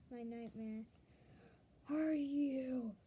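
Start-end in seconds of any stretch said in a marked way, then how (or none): 1.9-2.9 loud, drawn-out scream; screamed question. Acted. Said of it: "I screamed".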